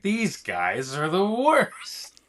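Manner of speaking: slow, drawn-out disgust rising at the end; outraged ant. Acted. Ant griping to fellow ants about aliens, mock-appalled. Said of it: "from a fellow ant's perspective".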